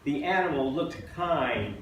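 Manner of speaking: measured, emphatic poem recital; poetry reading. Acted. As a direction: read it out as a poem to an audience. Reads own poem to audience.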